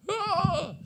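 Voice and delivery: short, high-pitched "ah" with a laugh; squeaky voice-testing cry; high yelp. Real, not acted. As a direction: yelp to shake the voice back. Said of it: "I need my voice back".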